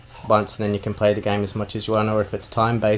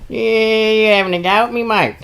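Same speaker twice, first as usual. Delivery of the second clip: high, loud mock bogan picking a fight; pitched-up ocker challenge; 12 semitones higher, 5 dB louder than usual. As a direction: bogan squaring up to the barman, hammed up. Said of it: "the little rat bogan guy's like".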